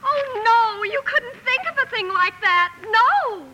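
high, wailing objection; dismayed protest. Acted. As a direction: refuse in pleading outrage. Amy protests giving breakfast away.

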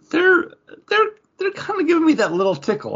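high-pitched, excited little squeal of delight; giddy high voice. Pretend. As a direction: gush like you're being tickled. Giddy put-on voice about crossovers.

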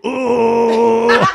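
high, loud laughing mock oh; mocking impression. Crew mocking a drive-thru customer, hammed-up high oh. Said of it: "just do an impression of that person".

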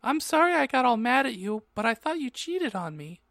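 high-pitched acted apology; high voice, easing down toward the end; girl's part. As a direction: plead in a high girlish character voice. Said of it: "I expelled".

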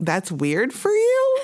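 incredulous retort. Retold or imitated. Retorting to surprised people.

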